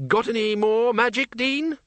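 high, forceful Archchancellor voice. Acted. Archchancellor questions the Dean.

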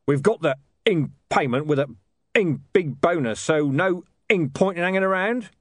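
lightly voiced gruff hitman; a hint of a blunt heavy; thug voice. Voices Mister Tulip.

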